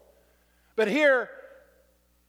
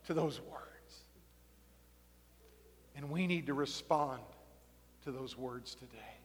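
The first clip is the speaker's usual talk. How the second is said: soft altar call; 6 semitones lower, 7 dB quieter than usual. Acted. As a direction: draw them inward gently. Quiet reflective altar call.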